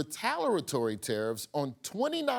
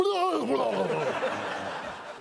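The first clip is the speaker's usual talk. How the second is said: high laugh; 5 semitones higher than usual. Real, not acted. Host cracks up on air.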